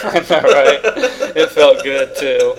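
laughing. Real, not acted. Laughing about nose picking.

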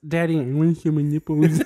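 put-on talking dog voice; mushy-mouthed pet pleading with Daddy; Izelle. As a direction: imitate a deaf dog talking. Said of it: "She says".

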